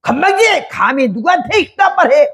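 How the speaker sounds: a high mock-furious snap at servants who dared advise him; arrogant outburst. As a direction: play the proud general snapping at his servants. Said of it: "아까 그 기세 봐서는".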